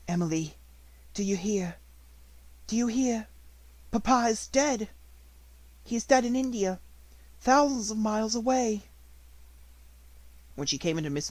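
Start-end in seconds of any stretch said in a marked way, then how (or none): 0.1-8.8 higher, louder grieving outburst to her doll; desperate child crying to her doll; wild cry. A narrator's reading. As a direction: cry it out wildly, half lost. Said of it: "cried out wildly".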